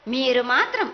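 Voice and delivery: ordinary delivery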